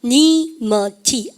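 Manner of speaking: "유너니머티" very slowly, broken into syllables; "유너니머티" stretched out for learners to follow; syllable-by-syllable pronunciation. Shown as a demonstration. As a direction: model "유너니머티" slowly, one piece at a time. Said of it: "발음할까요".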